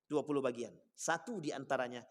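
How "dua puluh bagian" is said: ordinary talk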